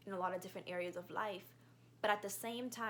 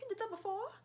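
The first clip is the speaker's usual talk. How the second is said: a hint of a much higher, skeptical girl's voice; doubtful girl; 13 semitones higher, faster than usual. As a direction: lightly mimic her disbelief. Girl doubting her braids.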